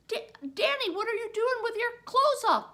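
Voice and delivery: high, loud, fast alarmed question; shocked to find him half-dressed; teacher shouting in disbelief at Danny. Retold or imitated. Teacher shocked at undressed boy.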